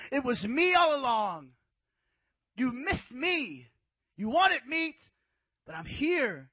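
God scolding the complaining people; God's voice. Retold or imitated. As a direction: thunder it down as an exasperated God.

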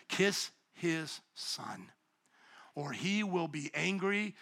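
soft and slow, each word drawn out; slow hushed reading. Shown as a demonstration. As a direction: read it quietly, like a solemn warning. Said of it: "Look at it again".

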